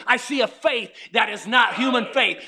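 ordinary delivery

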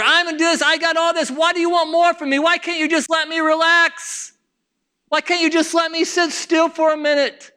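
high-pitched, put-upon protest; protesting complaint. Acted. Comfortable believer answers God's call, complaining in protest. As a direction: plead to be left alone, pitch held high.

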